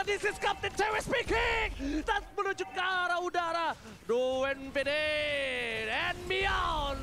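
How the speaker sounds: booming hype announcer voice; fighting game announcer. Pretend. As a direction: shout it like a pilot announcing. Caster hypes pilot-style quote.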